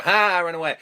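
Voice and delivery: put-on high, loud jeering kid; mocking taunt. Kids in a passing car taunting the cops, loud jeer. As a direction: jeer at the cops as you speed off.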